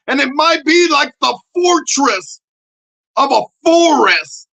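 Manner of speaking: high, loud complaining shout; mock outrage. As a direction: yell at her to put her arms down. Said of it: "I'm like".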